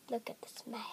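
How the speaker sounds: soft unvoiced whisper. Real, not acted. Gamer whispers while hiding from animals. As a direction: whisper it so the animals don't hear.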